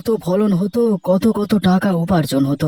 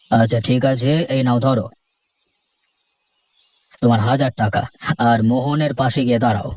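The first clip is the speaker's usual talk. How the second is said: lower, measured headman's voice; village headman; 7 semitones lower than usual. Acted. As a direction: speak down to the farmers as the headman, lower. Headman pays Rahul.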